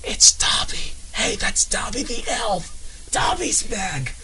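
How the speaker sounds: soft, slow excited whisper. Retold or imitated. Fan whispering to the seatmate about Dobby. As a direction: hiss the news to the neighbour. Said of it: "eighteen million comments of".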